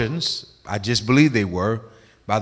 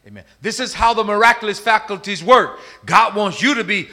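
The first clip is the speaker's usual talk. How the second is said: high, loud sermon delivery; forceful preaching; 7 semitones higher, 6 dB louder than usual. Real, not acted. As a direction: drive each phrase home to the congregation.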